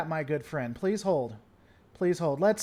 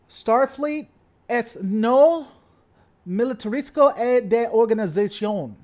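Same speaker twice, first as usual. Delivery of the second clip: Starfleet line in joking fake Spanish, louder; mock Spanish; 3 semitones higher, 6 dB louder than usual. Shown as a demonstration. Streamer jokingly switches the quote into mock Spanish. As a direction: ham it up in pretend Spanish. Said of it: "Okay, so let's do this".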